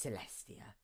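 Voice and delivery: whispered name. Acted. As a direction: breathe the name in a cold whisper. Cold final address.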